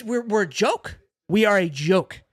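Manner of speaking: ordinary talk, nothing marked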